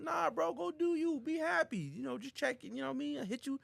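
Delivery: high-pitched, easygoing send-off; acted reassurance. Friend tells bro to go be happy, high-pitched reassurance. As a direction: let him off in a high voice.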